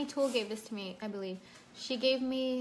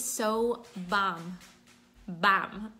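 put-on Jersey accent on a drawn-out "bomb"; 3 semitones lower, much slower than usual. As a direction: say it like you're from Jersey. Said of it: "say it in, like, a Jersey accent".

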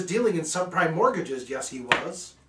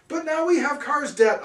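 high, loud alarmed yell; 11 semitones higher, 7 dB louder than usual. Acted. Narrator yells at the cat about the new car debt. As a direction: yell it in disbelief. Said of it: "I yelled".

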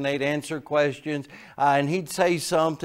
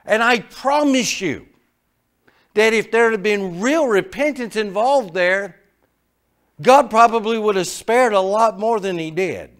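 loud, higher preaching voice; fervent preaching; 7 semitones higher, 8 dB louder than usual. Acted. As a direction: drive the point home to the congregation. Preaching at full force.

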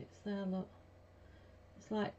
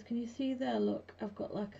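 ordinary talk, nothing marked

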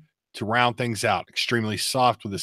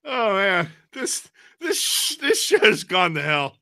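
high, slow, softening laughter-strained voice; worn out from laughing; 8 semitones higher, slower than usual. Real, not acted. Host declaring the show derailed, spent from laughing. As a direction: squeak it out while recovering from real laughter. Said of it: "I was laughing really hard at that".

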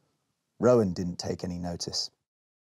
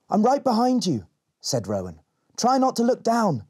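put-on encouraging girl; 12 semitones higher, 3 dB louder than usual. Rowan encourages on climb.

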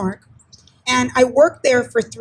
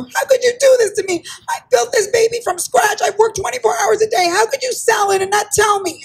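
much higher, heated accusation; outraged protest; 10 semitones higher than usual. Retold or imitated. Station manager confronting the boss who sold it, outraged. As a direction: confront them, hurt and indignant.

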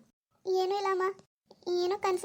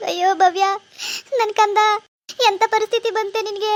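put-on loud anguished wail for her child; wailing lament; 2 semitones higher, 6 dB louder than usual. Mother crying out as her daughter Bhavya is led away, loud wailing lament. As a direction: cry out her daughter's name in grief.

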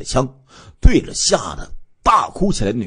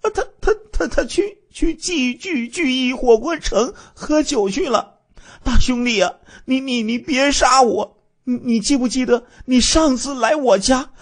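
high, stuttering, frightened begging; terrified stammering; 13 semitones higher than usual. Acted. Village woman with a gun at her head, stammering in terror. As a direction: stammer in panic, voice pitched high. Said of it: "结结巴巴地说".

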